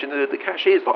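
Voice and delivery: usual speaking voice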